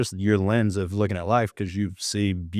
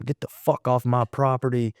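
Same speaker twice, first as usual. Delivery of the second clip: imitating angry homeowner; 4 semitones higher than usual. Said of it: "just dog cussing him".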